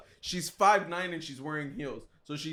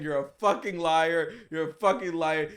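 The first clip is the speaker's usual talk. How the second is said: ordinary delivery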